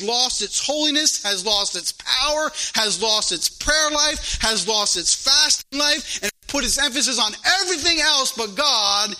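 impassioned pulpit rebuke, piling up the list; driving preacher cadence; preaching. Real, not acted. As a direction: build the charge item by item. Preaches against the church's failings.

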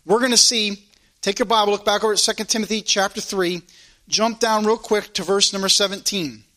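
ordinary talk

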